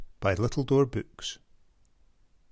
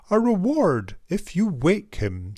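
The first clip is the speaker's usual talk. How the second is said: high, louder public announcement; mayor announcing; 7 semitones higher, 4 dB louder than usual. Acted. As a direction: proclaim it to the whole town. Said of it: "she announced to the town".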